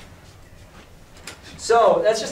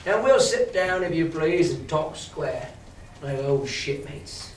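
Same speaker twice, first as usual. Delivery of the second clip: lightly voiced pirate. A narrator's reading.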